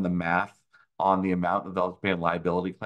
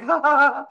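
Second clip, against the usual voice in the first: high, loud, hammed-up fake cheer; mocking fake laugh; 7 dB louder than usual. Mocking the nodding auditor, high fake laughter. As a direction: squeal out a phony agreeable laugh. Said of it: "And they're like".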